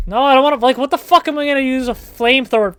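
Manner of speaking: panicked rant. Real, not acted. Panicking at an enemy in the game, loud and fast. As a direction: rattle it off in a flustered panic.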